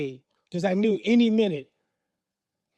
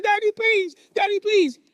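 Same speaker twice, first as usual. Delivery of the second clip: very high, desperate begging; pleading boys; 13 semitones higher, 5 dB louder than usual. Retold or imitated. Boys to father holding the belt, desperate pleading. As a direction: imitate children wailing for mercy.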